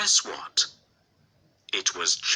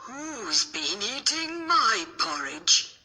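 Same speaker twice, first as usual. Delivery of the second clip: much higher, slow mama bear voice; high, slow mother bear; 11 semitones higher than usual. A narrator's reading. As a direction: lift into a sweet motherly bear. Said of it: "and said".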